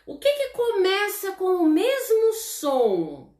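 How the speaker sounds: put-on high, playful question to children; teacher asking kids. Shown as a demonstration. Teacher modelling a question for young pupils, high and playful. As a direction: lift it up like quizzing a class. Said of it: "Que a gente fala assim".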